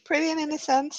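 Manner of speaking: mock innocent voice. Mocking innocent character.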